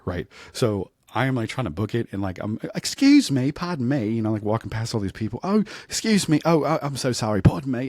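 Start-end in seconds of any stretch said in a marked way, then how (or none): 2.8-4.1 high, loud mock Australian "Excuse me"; camp Australian accent. 5.4-7.9 high, loud mock Australian "Excuse me"; camp Australian accent.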